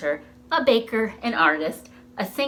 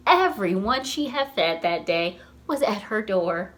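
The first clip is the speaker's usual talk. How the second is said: usual speaking voice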